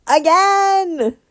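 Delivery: high, loud exclaim; excited cry. Retold or imitated. Fan reacting to the finale, excited cry. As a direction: cry it out in disbelief. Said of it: "I was like".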